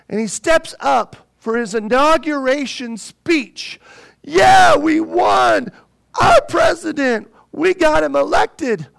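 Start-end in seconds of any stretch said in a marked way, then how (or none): 4.2-8.9 a loud, high whoop of victory; mock crowd celebrating their winner; cheering crowd. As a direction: cheer like a crowd that just won.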